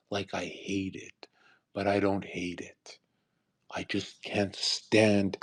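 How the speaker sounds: ordinary talk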